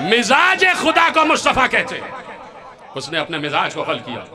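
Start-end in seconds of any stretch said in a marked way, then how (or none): 0.1-2.2 shouted much higher, driving the point home; fervent shout. Real, not acted. Preacher shouting the climax to the congregation. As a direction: shout it out to the hall.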